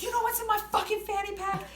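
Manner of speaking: hammed-up threatening yell; mock threat. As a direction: bark the threat like a tough guy. Man repeating his threat.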